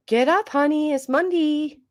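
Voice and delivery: high, singsong wake-up call; parent waking child. Acted. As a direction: coo it up high like a cheery parent. Voices waking up her kid.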